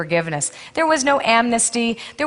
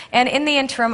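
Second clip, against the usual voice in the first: ordinary talk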